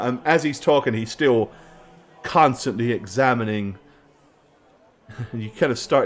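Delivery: ordinary talk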